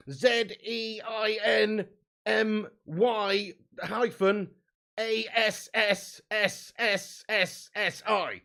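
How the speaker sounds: slow spelling, S hissed long; drawn-out s like a snake. Pretend. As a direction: spell it out, hissing the S. Spelling name into the book.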